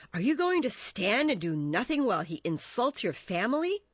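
appalled. A narrator's reading. Mercutio urges Romeo to answer the insult, appalled. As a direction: challenge him in disbelief. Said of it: "gasped Mercutio".

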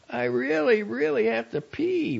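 put-on high, urgent child's voice; a small boy who badly needs the toilet; little boy voice. A narrator's reading. Little boy Ralphie pleads.

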